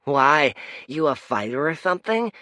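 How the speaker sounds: put-on high, loud, quick street kid; grinning urchin. Street urchin teasing Kaya, high and grinning. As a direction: tease her with a grin. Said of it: "said the street urchin with a grin".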